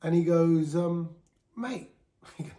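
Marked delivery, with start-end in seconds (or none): 1.6-1.9 a light Essex "Mate," pitched up, falling; friendly Essex builder saying "Mate,"; Essex accent. Retold or imitated. Builder friend consoling Tommy, light Essex accent. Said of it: "you can tell he's from Essex".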